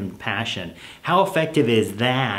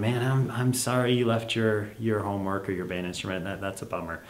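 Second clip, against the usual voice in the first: much softer, fading lower; sympathetic parent; 3 semitones lower, 7 dB quieter than usual. Acted. Parent sympathizing with kid who forgot homework, soft. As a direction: commiserate gently.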